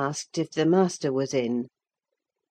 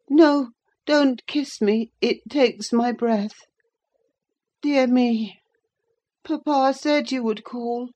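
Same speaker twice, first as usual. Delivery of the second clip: put-on fretful invalid boy; 8 semitones higher than usual. A narrator's reading. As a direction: fend her off, short of breath and fretful. Linton pushes off Catherine's embrace.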